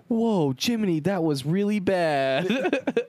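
high, slowed cartoon narrator; hammy high drawn-out disapproval; mock cartoon scolding. Voices the PowerPoint text.

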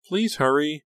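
high, loud plea; pressing, high-pitched entreaty; urging. A narrator's reading. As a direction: hurry them along eagerly. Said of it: "Honey urged".

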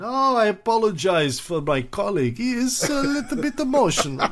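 smooth it over, all charm; Stalin. Retold or imitated. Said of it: "this is Stalin's attempt to make a good impression".

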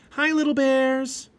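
high, loud singsong greeting; calling out. A narrator's reading. Girl greeting bear cubs in the snow, calling out. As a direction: stretch it out, calling to little animals. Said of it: "Annie called".

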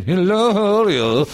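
high stiff overacted greeting; same stiff take. Actor repeating his stiff greeting to the director. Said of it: "walked back in".

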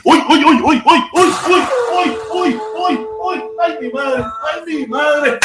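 high, loud yelps of alarm with laughter; loud startled outcry. Real, not acted. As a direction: cry out in high-pitched, laughing shock. Startled outburst during the live stream. (gritos agudos de asombro, entre risas)

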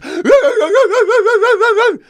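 a high, loud, burst laugh like a yelping dog. Retold or imitated. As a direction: let out a wild yelp of a laugh. Imitating the dog's fit.

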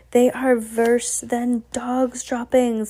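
drawn-out, disgusted witch pronouncement; mild screech. A narrator's reading. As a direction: screech it slowly as the ranting witch. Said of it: "screeched the Grand High Witch".

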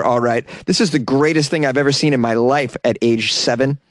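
normal talking voice

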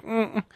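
soft closed-mouth "mm-mm"; hushed little hum; approving hum. Retold or imitated. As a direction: hum it quietly, as a wordless answer. Retells his hum to the server.

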